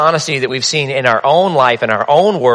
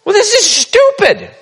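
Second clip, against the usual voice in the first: high, fed-up scoff; husband losing patience over the ham; exasperated husband. Retold or imitated.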